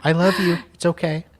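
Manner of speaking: high, cooing playful reassurance; lightly teasing, high-pitched comfort; playful consoling. Pretend. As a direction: coo it high, as if soothing a friend. Mock consoling of co-host.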